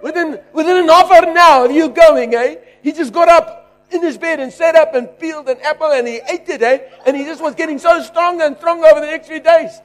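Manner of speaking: daughter's report. Retold or imitated. Daughter telling the preacher her father recovered. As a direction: tell the good news in her voice. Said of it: "I hear her say".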